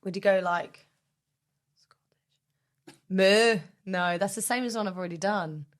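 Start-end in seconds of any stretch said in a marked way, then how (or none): 1.8-3.0 breathy whisper of the word; whispered Scottish. Pretend. Whispering while working out the cow voice. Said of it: "I want to do Scottish". 3.1-3.6 loud cow moo; cow impression. Doing a cow moo on the podcast. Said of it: "another impression of a cow".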